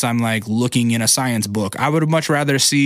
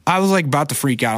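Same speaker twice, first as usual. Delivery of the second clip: ordinary delivery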